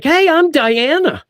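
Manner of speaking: very high, drawn-out Diana greeting; mock perky introduction. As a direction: introduce yourself in a high, slow, put-on voice. Voices Diana introducing herself to Batman.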